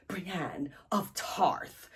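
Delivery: very slow, hushed dramatic whisper; whispered echo. Real, not acted. Echoing the reveal of who she meets, whispered echo. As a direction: breathe the name out slowly, barely audible.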